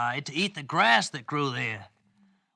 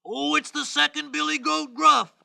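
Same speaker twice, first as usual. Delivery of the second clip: higher-pitched middle billy goat; high storybook goat voice, a little louder; second goat's voice; 8 semitones higher, 4 dB louder than usual. Acted. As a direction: speak up brightly as the middle goat. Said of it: "a little louder than the voice of the first".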